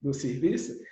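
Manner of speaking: usual speaking voice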